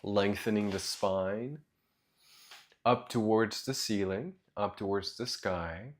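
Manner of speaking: soft, low meditation voice with long pauses; guided meditation. Real, not acted. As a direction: guide them gently into their breath, quietly and low.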